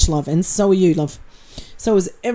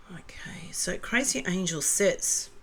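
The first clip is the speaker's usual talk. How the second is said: whispering; 15 dB quieter, slower than usual. Real, not acted. Unvoiced whisper.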